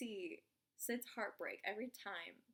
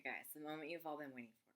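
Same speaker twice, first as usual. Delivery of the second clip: ordinary talk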